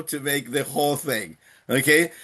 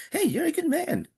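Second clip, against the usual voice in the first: breezy offhand compliment, pitched up; casual high "hey"; 13 semitones higher, 3 dB quieter than usual. Shown as a demonstration. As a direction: toss it off like a saying. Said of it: "we always say".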